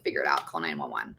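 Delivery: low, dismissive dad; curt dad voice. Retold or imitated. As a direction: toss it off like an uncaring father. Dad's curt reply, voiced low.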